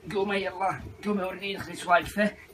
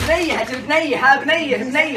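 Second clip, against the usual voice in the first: loud, higher repeat of بنية in disbelief; shocked outburst; 8 semitones higher, 6 dB louder than usual. Acted. Husband hearing his wife wants a baby girl, stunned shout. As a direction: blurt بنية out again and again, stunned.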